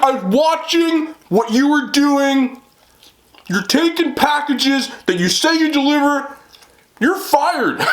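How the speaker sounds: slow, high-pitched accusing holler; imitated yelling. Homeowner scolding the delivery lady he watched, imitated yell. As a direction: act out a furious telling-off. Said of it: "I'm sorry I'm yelling".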